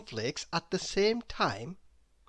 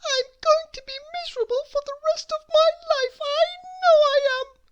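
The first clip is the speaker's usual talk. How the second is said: very high, fast, louder sobbing wail; crying little girl; 9 dB louder, faster than usual. Acted. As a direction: blubber in despair. Crying Miss Giggles.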